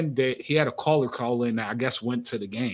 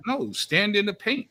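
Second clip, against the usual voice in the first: ordinary talk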